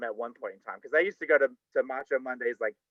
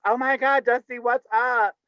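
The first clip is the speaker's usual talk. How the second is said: excited greeting; 8 semitones higher, 3 dB louder than usual. Retold or imitated. Stripper greeting an old friend with excitement. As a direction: gush at a long-lost pal.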